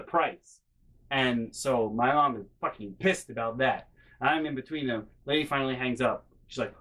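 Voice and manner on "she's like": usual speaking voice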